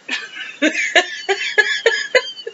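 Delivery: high breathy giggle; light high giggle. Real, not acted. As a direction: giggle at your own act. Laughs after impression.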